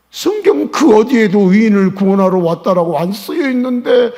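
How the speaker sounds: loud, high, driving sermon; fiery preaching. Acted. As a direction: hammer the point to the crowd. Preaching at full force. (열정적으로 외치는 설교)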